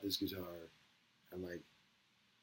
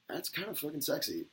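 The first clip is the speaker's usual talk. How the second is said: mock swoon; 9 semitones higher than usual. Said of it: "I'm like".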